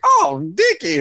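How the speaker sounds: put-on high, slurred call of a drunk; hammed-up drunk calling out; drunk character voice. Imitating a drunk Trailer Park Boys character.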